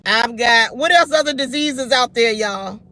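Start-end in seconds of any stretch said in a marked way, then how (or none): 0.1-0.8 mock confession. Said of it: "and they say".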